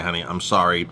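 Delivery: usual speaking voice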